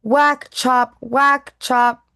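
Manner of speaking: storyteller acting out axe strikes; loud chopping sounds. A narrator's reading. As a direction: hit each whack like an axe blow. Reading chopping sound words.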